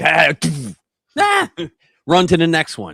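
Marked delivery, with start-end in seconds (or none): 0.0-1.5 mock yell. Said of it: "You're like".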